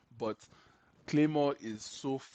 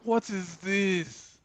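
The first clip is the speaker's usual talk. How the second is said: slow, higher pitched outraged disbelief; higher incredulous; 6 semitones higher, much slower than usual. Retold or imitated. To himself watching One Piece on the telly, higher slow outrage. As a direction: lift it up high, slowly, in mock outrage. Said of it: "I'm just like".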